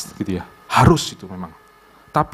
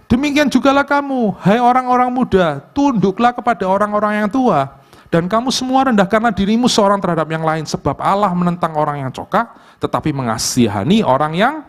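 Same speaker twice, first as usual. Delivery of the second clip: much higher solemn reading voice; scripture reading; 7 semitones higher than usual. Acted. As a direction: proclaim the verse to the congregation. Reads 1 Peter 5:5 aloud.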